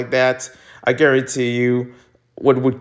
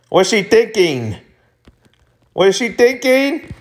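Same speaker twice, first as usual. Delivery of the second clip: climbing higher each time; rising alarm; 10 semitones higher than usual. Acted. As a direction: build mock alarm.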